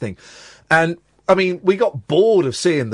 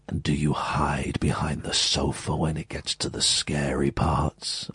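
much lower, whispered conspiratorial question; deep hushed voice; 12 semitones lower, 7 dB quieter, slower than usual. Retold or imitated. Tom Baker teasing a boy about scary parts, low whisper. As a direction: lean in and whisper it low like a secret. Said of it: "He said it in this slow, deep voice".

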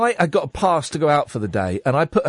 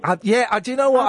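usual speaking voice

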